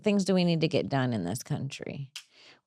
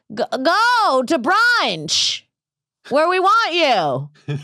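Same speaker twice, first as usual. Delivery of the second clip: high, drawn-out holler; hollered mock slogan, long high vowels; mock rally cry; 8 semitones higher, 3 dB louder, slower than usual. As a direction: call it out like a chant, stretching the words. Calling out mock political demand.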